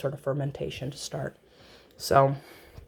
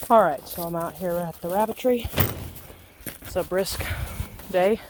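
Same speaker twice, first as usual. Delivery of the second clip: out of breath. Real, not acted. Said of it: "Sorry for my panting".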